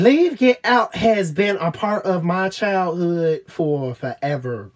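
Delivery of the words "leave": ordinary delivery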